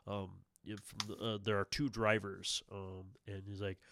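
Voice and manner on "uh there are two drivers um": ordinary talk, nothing marked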